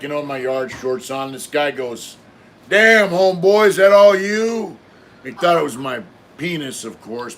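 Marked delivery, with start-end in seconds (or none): 2.7-4.8 loud, high, drawn-out mock amazement; amazed fellow prisoner. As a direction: holler it like an astonished inmate in the yard.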